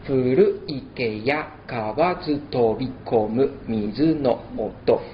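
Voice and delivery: slow, careful haiku in Japanese; slow Japanese haiku reading; Japanese recitation. Shown as a demonstration. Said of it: "Empezamos con el ritmo cinco siete cinco".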